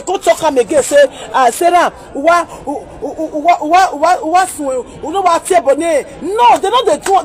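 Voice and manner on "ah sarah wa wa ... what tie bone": stuttering repeatedly on "wa"; mock stammered Bini. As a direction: imitate someone stuck on "wa, wa, wa". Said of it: "they no dey talk am like that".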